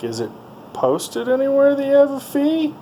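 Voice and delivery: retold pushback at the fee, arch and high; incredulous retort. His own incredulous retort.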